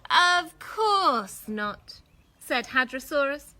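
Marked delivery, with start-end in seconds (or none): 0.1-2.0 reassuring. Acted. Dinosaur reassuring, drawn out.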